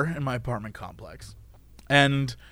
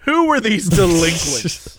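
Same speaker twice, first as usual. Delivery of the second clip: slow mock accusation; slower than usual. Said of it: "I'm gonna say delinquents".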